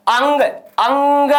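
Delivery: "अंग" with the vowel humming out through the nose; nasal sound demo. Shown as a demonstration. Teacher shows students the sound from the nose, "अंग" nasal and drawn out. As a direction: stretch "अंग", letting the nasal ring. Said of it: "कहां से निकल रही है? नाक से".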